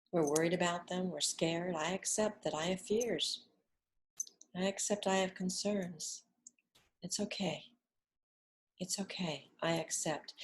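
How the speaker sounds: soft, unhurried affirmations that fade at each end; guided affirmation. Acted. Teacher guiding listeners through acceptance, softly. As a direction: murmur each acceptance gently.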